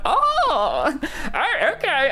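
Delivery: high-pitched dawning "oh"; hammed-up sudden understanding; mock realisation. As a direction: squeak it as the hint finally lands. Retold sudden realisation of the clue.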